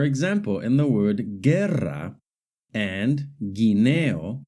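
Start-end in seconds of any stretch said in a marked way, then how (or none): 1.4-2.2 guerra with a silent U, starting as "ge". Shown as a demonstration. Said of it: "the U is silent". 3.4-4.4 guineo with a silent U, starting as "gi". Shown as a demonstration. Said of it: "the U is silent".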